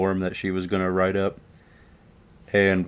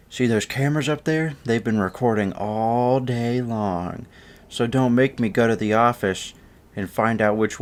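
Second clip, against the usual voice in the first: raised-pitch mock warning; threatening teacher; 4 semitones higher than usual. Teacher warns the class about cameras, threatening. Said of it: "she starts threatening".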